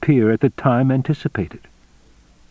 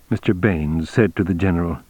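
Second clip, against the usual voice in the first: usual speaking voice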